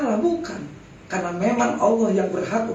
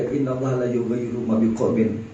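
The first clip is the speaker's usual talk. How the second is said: Quran verse recital; 9 semitones lower than usual. Retold or imitated. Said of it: "Wah, Ustaz, kan itu ada".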